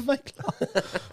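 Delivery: high, silly put-on voice; mock sitcom line. Friend echoing a sitcom character's line, hamming it up. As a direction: ham up a sitcom quote. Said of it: "Simon off In Between Us".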